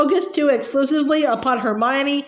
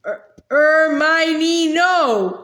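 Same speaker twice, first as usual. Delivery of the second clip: muffled shout through gag; 3 semitones higher, much slower than usual. A narrator's reading. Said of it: "shouted Ron through his gag".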